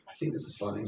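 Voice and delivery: quiet, breathy self-assured murmur; hushed inner voice. Retold or imitated. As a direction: whisper it to yourself. Writing a joke, thinking aloud.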